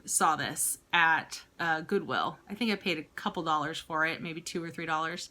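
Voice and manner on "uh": normal talking voice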